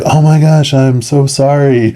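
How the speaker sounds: high-pitched, aghast retold apology; hammed-up "oops, so sorry" voice; acted shocked apology. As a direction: imitate her horrified apology. Retold shocked apology to dying man.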